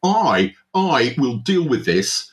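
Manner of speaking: pompous diner pitched high in fury; outraged half-elf host. Acted. Portly half-elf NPC berates visitor.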